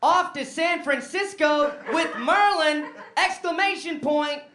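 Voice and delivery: high, loud, slow gush; over-the-top glee; gushing excitement. Retold or imitated. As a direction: read the text like a thrilled giddy note. Reading drunk text.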